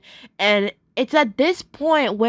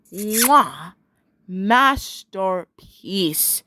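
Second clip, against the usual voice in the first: low, very drawn-out savouring; chef's kiss praise; 3 semitones lower, much slower than usual. Real, not acted. Fan rating Dima's part of the spooky song, chef's kiss praise. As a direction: stretch it out with theatrical relish.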